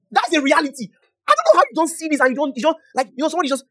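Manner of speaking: high, loud, tumbling excitement; can't contain the joy; excited preaching. Real, not acted.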